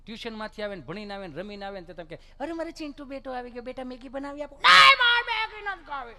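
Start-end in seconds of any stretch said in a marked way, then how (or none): 2.4-4.5 higher-pitched imitation of a fussing parent; acted parental cooing; doting parent. Doting parent offers child food. 4.5-6.2 very loud, high-pitched bratty refusal; hammed-up tantrum shriek; mock child tantrum. Spoilt child refuses food, acted.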